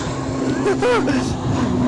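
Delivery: high, loud burst of laughter; helpless laugh. Real, not acted. Host laughing at his own webcam mix-up, loud helpless laugh. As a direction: crack up at your own mistake.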